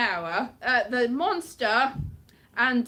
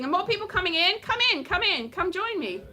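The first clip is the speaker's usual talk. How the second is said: higher, welcoming call to newcomers; calling people in; 6 semitones higher than usual. Real, not acted. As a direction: beckon an arriving crowd over.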